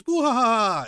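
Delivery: high, loud mocking evil cackle; villain cackle. Imitating the nobles laughing at the slaughter, high villain cackle. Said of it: "you just hear laughing from the celestial dragons".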